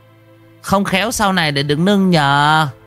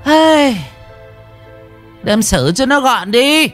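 cruel calling out; slower than usual. Acted. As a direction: call out to the son outside. Calls out order to son.

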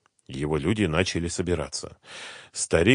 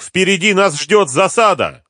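loud, high-pitched warning shout; shouted alarm; 13 semitones higher, 9 dB louder than usual. A narrator's reading. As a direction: cry out to warn everyone of danger. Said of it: "крикнул один из них". (крик тревоги, высоко и громко)

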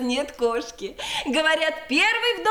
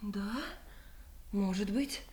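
low, very soft reply; doubtful murmur; 7 semitones lower, 17 dB quieter than usual. Acted. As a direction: concede quietly, unconvinced. Unsure after strange sound.

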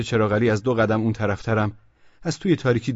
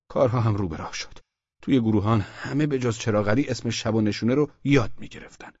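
usual speaking voice

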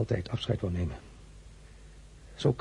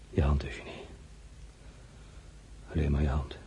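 low, hushed and tender; tender plea; 6 semitones lower, 7 dB quieter than usual. Acted. Emperor gently asking Eugenie for her hand, tender and hushed. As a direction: ask softly for her hand.

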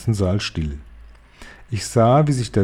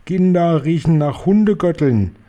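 high, shrill witch shriek; high shriek of the witch queen; 7 semitones higher, 3 dB louder than usual. Acted. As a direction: screech it with disgust. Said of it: "kreischte die Hoch- und Großmeisterhexe".

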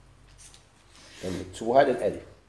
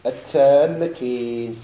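التمكين stretched very slowly and louder; drawn-out term; 4 dB louder, much slower than usual. Shown as a demonstration. Teacher names the first type for students, drawn out. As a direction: announce التمكين long and full. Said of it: "كاين يقول له".

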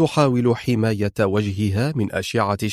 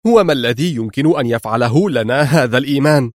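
plain reading voice

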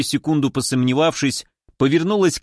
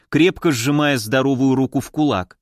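usual speaking voice

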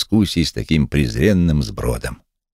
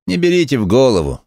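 higher, mildly smirking brush-off; 9 semitones higher, 3 dB louder than usual. A narrator's reading. Lame man waves off the methodist's rebuke, smirking. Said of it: "всё та же ухмылка".

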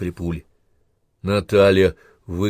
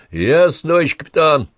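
high, loud acknowledgement; raised, carrying soldier's reply; calling back; 8 semitones higher, 7 dB louder than usual. A narrator's reading. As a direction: shout back to your captain. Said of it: "откликнулся разведчик".

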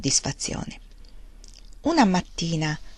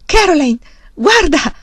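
loud, high calling out to her; excited cry, pitched well up; trembling excitement; 9 semitones higher, 9 dB louder than usual. A narrator's reading. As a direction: call her to look, thrilled and loud. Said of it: "disse Charles tremando".

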